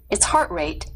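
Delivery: plain reading voice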